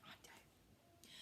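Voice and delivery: soft conspiratorial whisper. Real, not acted. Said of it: "aside to children".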